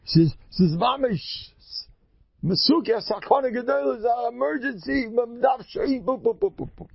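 high-pitched, imitated emergency plea; urgent phone message. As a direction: mimic a panicked message, tailing off into babble. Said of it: "und der Mensch sagt". (נאכגעמאכטע דרינגענדע בקשה)

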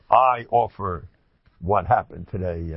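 normal talking voice

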